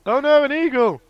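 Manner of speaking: high, loud fake alarm; hammed-up eagle panic; mock panic. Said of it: "pretend excuse on phone".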